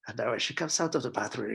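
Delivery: ordinary talk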